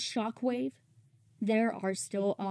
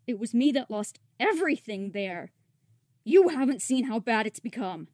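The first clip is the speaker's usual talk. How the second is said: bitter rebuke building louder; spitting rebuke; 7 dB louder than usual. Acted. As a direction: start contained, end spitting the words at the leader. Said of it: "Isleta One spits at Optimus Prime".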